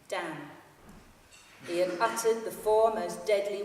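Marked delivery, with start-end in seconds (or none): none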